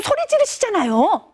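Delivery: acted daughter's retort; accusing teen. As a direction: cut dad off with an indignant comeback. Daughter cuts in on father, accusing.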